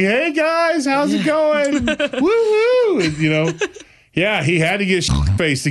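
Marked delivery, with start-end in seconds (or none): 0.0-1.8 put-on drunk party dad. Sleepover dad greeting the boys, high and goofy. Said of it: "the dad was always like". 1.9-3.0 very high whooping cheer; drunk dad whoop. Retold or imitated. Drunk dad cheering at the kids' sleepover, high whoop. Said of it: "the dad was always like".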